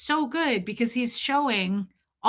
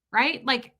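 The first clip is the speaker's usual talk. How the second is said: ordinary talk, nothing marked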